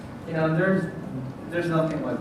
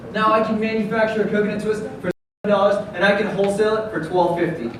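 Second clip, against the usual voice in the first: upbeat rehearsed sales pitch, projected; confident startup pitch; 5 semitones higher, 10 dB louder than usual. Acted. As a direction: sell it to investors with energy. Delivers rehearsed pitch to judges.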